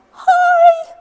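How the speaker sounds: teacher's squeaky high-pitched demo. Shown as a demonstration. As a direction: squeak it out as high as you can. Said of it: "in a really, really squeaky, high-pitched voice".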